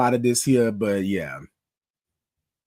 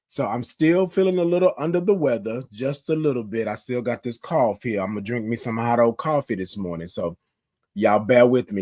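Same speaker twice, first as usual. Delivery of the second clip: ordinary talk, nothing marked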